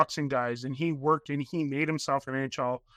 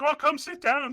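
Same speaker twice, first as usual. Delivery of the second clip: imitating eager fans, higher and a bit louder; a hammed-up friendly invite, pitched up; inviting fans; 11 semitones higher, 4 dB louder than usual. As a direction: fans waving him over, mock-eager. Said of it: "they're like".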